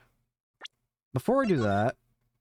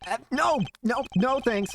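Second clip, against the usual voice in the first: high, flustered no thanks; panicked refusal; 11 semitones higher than usual. Acted. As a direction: back away in a hurry.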